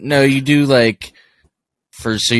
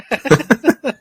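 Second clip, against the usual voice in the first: high laugh; 13 semitones higher than usual. Real, not acted. Laughs at own bad accent.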